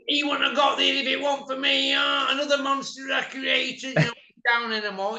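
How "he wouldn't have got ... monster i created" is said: higher, put-on boastful voice; mock Big Ron brag. Boasting he made the champion, higher put-on brag. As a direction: crow like a proud trainer.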